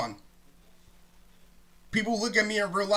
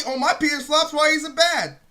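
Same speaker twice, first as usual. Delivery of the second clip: higher, louder sarcastic quote; mild mocking mimicry; 5 semitones higher, 5 dB louder than usual. Throwing the relatability excuse back at listeners, mild mocking mimicry. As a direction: parrot it back mockingly, pitched up. Sarcastically voicing the mocked remark.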